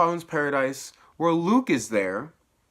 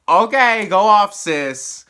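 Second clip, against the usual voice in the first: loud, raised-pitch mock cheering; sassy outburst; 8 semitones higher, 10 dB louder, slower than usual. Real, not acted. Viewer answering a show character's money talk, loud sass. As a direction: call it out with playful sass.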